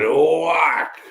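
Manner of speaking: long stretched whack sound effect; big drawn-out mimicked wallop; imitated punch. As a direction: draw it out as the fist lands.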